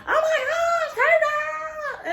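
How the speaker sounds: high shrieking greeting, mocking a loud girl; loud excited girl. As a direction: imitate a girl shrieking hello on the bus. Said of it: "she was like".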